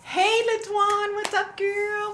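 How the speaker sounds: very high, slow, drawn-out hello; high sing-song greeting. Real, not acted. Live streamer squealing hello to a viewer.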